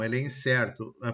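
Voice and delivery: normal talking voice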